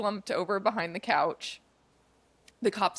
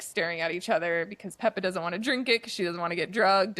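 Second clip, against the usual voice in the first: ordinary talk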